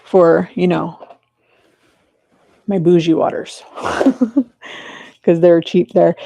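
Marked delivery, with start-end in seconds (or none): 3.7-5.0 light laugh. Real, not acted. Laughing at her bougie waters.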